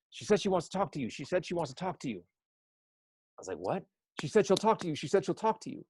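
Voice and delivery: high, urgent, hurried repeats; mother rushing downstairs with news, frantic and high. Retold or imitated. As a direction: blurt it out in a rush, breathless with news. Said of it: "comes downstairs almost frantic".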